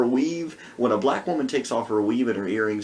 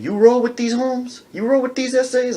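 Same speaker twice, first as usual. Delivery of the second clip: put-on Chicano accent; 10 semitones higher, 5 dB louder than usual. Man voiced.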